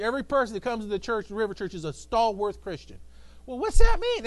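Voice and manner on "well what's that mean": high, loud mocking question; mock skeptic. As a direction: toss it up like a doubter.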